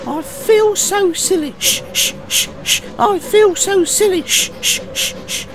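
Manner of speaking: high, loud engine voice with steam puffs; squeaky sulking engine in train rhythm; puffing sulkily. Acted. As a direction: chug the words, then puff shh-shh. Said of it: "He puffed sulkily to the station".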